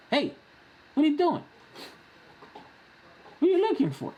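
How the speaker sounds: high, playful dog-directed voice; talking to the dog. Real, not acted. Voicing talk to the restless dog.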